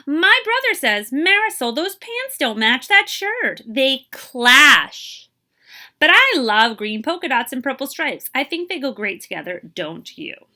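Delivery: lively, expressive picture-book reading; teacher reading a storybook to young kids; reading to children. Acted. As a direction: read it aloud to a class, bringing each line to life. Teacher reads picture book aloud.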